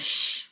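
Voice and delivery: sh as one hushing sound; letter sound. Shown as a demonstration. Teacher gives the sound to replace, sh as a hush. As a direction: make the sh noise, not the letter names. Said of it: "what sound to change".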